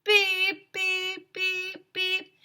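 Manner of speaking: high, loud mock alarm beeps; high, even electronic beeps; imitated beeping. A narrator's reading. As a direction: beep like a smoke detector. Smoke detector keeps beeping.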